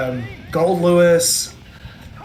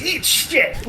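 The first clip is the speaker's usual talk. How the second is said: quoted game line; 10 semitones higher than usual. Retold or imitated. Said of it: "he goes".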